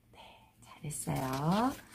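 soft breathy whisper of praise. Real, not acted. Teacher whispering praise to student after drill. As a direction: whisper it like a gentle pat on the back.

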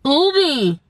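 sharp gasp. Real, not acted. Startled by a hiccup.

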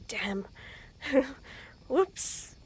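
ordinary delivery

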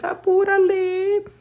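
squeaky high hurry-up; high-pitched apúrale, urging herself on; apúrale squealed way up high. Pretend. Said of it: "'Cause I feel like I have to".

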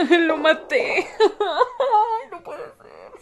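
laughing through mock despair; real guilty giggling; guilty laughter. Real, not acted. Kills companion by accident.

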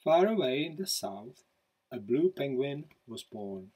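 lively picture-book read-aloud; storybook narration. A narrator's reading. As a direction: read it like to children at bedtime. Author reads picture book aloud.

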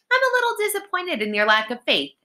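high-pitched smug sister; self-satisfied, rising high; gloating. A narrator's reading. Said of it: "Alex gloated".

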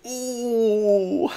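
high, drawn-out mock ooh; crowd ooh. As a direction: imitate the store crowd's shocked ooh. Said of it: "And they all went".

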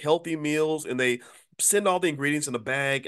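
normal talking voice

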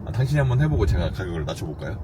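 normal talking voice

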